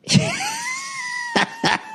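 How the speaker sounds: high, gleeful cackle; real burst of high laughter; delighted laugh. Real, not acted. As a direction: crack up at your own story. Laughs at his own comeback.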